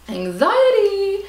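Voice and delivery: loud, high, drawn-out mock cry; anxious wail. Storyteller dramatising airport panic, high exaggerated wail. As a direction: wail the word out slowly for comic drama.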